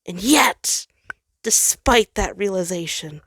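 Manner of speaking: usual speaking voice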